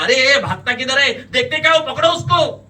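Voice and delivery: very loud, high yell to bystanders; alarm-raising shout. A narrator's reading. Man to crowd as suspect breaks free, shouting. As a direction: rouse the street to seize him.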